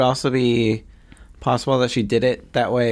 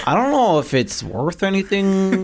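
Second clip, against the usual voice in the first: put-on Utah accent; 5 semitones higher than usual. Co-host imitating the guy Jodi saw in Utah, mock Utah accent. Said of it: "It was a Utah accent".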